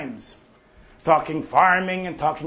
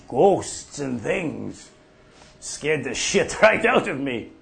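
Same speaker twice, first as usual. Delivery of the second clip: normal talking voice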